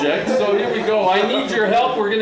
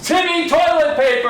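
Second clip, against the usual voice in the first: showman announcement; 7 semitones higher, 4 dB louder than usual. Acted. Announcing the puppet's name.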